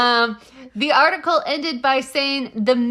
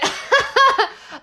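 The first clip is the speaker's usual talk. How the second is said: high giggling burst; embarrassed laugh; 4 dB louder than usual. Real, not acted. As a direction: laugh at your own failed attempt. Gives up on the accent.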